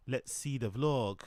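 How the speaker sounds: slow, drawn-out "Let's see the vlog", leaning on "vlog"; slow emphatic repeat. Shown as a demonstration. Streamer repeating himself to start the video, slow and deliberate. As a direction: stretch "vlog" out slowly with a falling end.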